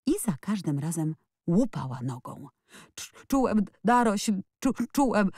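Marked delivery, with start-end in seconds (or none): 2.9-5.3 choked stammer, breaking off mid-word; boy stammering out what he felt. A narrator's reading. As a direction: force the words out in halting bits. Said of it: "wykrztusił Colin".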